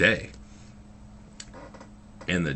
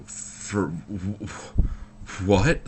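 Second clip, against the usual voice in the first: stammering disbelief; 4 semitones higher, 3 dB louder, much slower than usual. Retold or imitated. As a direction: sputter airily, then burst out a high, loud "what". Retold stammering shock at husband's thanks.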